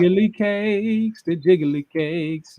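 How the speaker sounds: drawn-out, savoring "jiggly cakes"; slow drooling emphasis. Acted. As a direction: stretch it out like you're drooling. Hyping up the photo.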